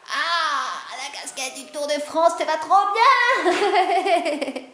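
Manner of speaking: high, drawn-out gushing squeal breaking into laughter. Acted. Teasing someone who got a Tour de France cap, gushing. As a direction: coo in over-the-top delight, stretching the words.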